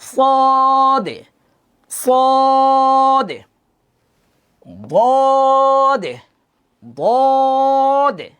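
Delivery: long, drawn-out Arabic letter names; slow, held Quran letter names; Arabic letter drill. Shown as a demonstration. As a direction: stretch each letter name slowly, teacher style. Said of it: "আমার মুখের দিকে খেয়াল করেন".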